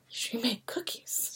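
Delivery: hushed, slow conspiratorial whisper; whispered gossip. Retold or imitated. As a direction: pass it on like a secret. Coworkers gossiping about cookies.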